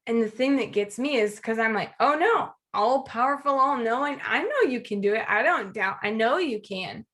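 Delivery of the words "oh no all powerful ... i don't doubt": ordinary talk, nothing marked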